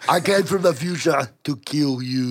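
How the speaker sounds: mock-menacing Terminator impression; Arnold. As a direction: announce it like a killer cyborg. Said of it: "jerking off to too much Arnold".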